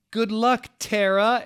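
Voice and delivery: loud, high cheer of a crowd; cried out. A narrator's reading. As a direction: call after her with full voice. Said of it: "everyone cried".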